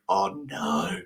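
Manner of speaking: soft low dismayed oh no; dismayed hush. Retold or imitated. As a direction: let it drop, quietly dismayed. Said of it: "I'm like".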